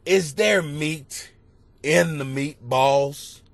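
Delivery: slow, drawn-out innocent question; slow child question. Retold or imitated. Father quoting his son's dinner question, slow and drawn out. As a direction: ask it slowly like a dreamy kid. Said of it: "He said".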